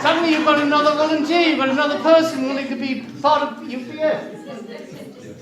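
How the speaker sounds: loud, fast, laughing enthusiasm; excited. Real, not acted. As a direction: sell it with delighted energy. Enthusing during charity auction.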